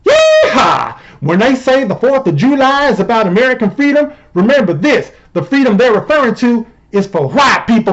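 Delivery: hammed-up yeehaw Southern accent; mock indignant Southern good-old-boy. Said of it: "I know that the Southern accent".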